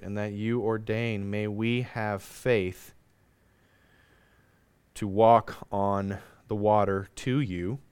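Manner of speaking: measured prayer with long pauses between phrases; public prayer. Real, not acted. Preacher prays for faith in life's storms, deliberate spoken prayer.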